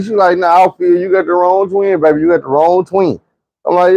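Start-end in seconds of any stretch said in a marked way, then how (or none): 0.4-3.2 higher-pitched imitation of a woman brushing someone off; mimicking a girl's brush-off; lightly voiced woman's rebuff. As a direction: lift the pitch, correct him. Voices first twin rebuffing him.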